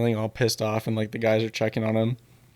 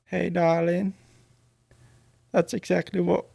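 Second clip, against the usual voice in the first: imitation Southern belle accent; attempted Southern accent; 7 semitones higher than usual. Shown as a demonstration. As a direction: test out a bad Southern accent, pitched up. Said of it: "why can't I do a Southern accent?".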